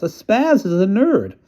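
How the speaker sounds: high, slow spelled-out answer; exasperated explaining. Acted. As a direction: spell it out for her slowly. Kid explaining slang to mom.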